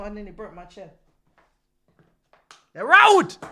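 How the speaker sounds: ordinary delivery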